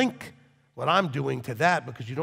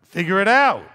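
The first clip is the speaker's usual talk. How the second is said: loud, higher, forceful command; exasperated shout; 4 semitones higher, 9 dB louder than usual. Acted. Rabbi answering a helpless congregant, loud and exasperated. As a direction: bark it to cut off excuses.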